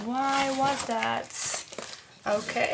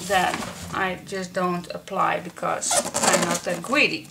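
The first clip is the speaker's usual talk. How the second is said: usual speaking voice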